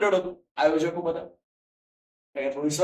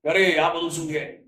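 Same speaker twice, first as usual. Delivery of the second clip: ordinary talk, nothing marked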